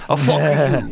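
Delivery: put-on laughing Chicago accent snap; Chicago accent, tossed off with a laugh. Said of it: "In a Chicago accent".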